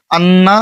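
আন্না slow with a held nasal ন্ন; nasal ghunna demo. Shown as a demonstration. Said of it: "নাকের বাসি হইতে গুণনা".

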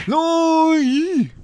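long, very high, loud wail; drawn-out high cry of frustration; anguished gamer scream. Real, not acted. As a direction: scream it out high as the game is lost. Player loses the round again.

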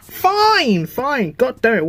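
high, sulky drawn-out fine; exasperated. Acted. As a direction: give in with a whiny huff. Grudging reply.

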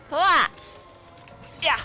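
loud high shout for help; calling for help. Real, not acted. Player shouting to the partner for help, loud call. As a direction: yell across to your teammate.

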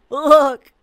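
loud, high call for attention; excited shout. Pretend. Player calls viewers to look at the cat, excited shout.

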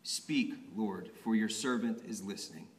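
hushed instruction. Retold or imitated. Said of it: "you say".

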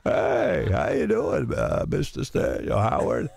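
Clark Schmidt impression. Howard mimics the program director greeting him, mock DJ voice. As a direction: ham up the program director's on-air voice. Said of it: "and he talked like this".

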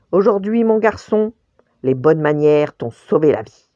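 loud, emphatic mother-bear moral; ourse moralisatrice. A narrator's reading. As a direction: deliver the moral loudly and firmly. L'ourse fait la morale au lapin. (leçon appuyée de maman ourse)

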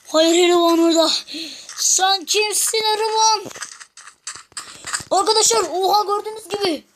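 high, loud frantic yelling; frantic shouting. Real, not acted. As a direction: yell to viewers to run in panic. Boss appears, yells to flee.

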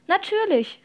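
high, cheerful confirmation; beaming exclamation. A narrator's reading. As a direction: call it out brightly to reassure. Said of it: "rief Toasty und strahlte ihn an".

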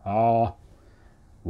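loud, hollow open "aah"; wide resonant vowel. Shown as a demonstration. Coach demonstrates English going wide, loud open resonant "aah". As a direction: widen the inner space on "aah". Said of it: "ワイドになるというか".

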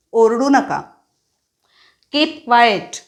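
ordinary delivery

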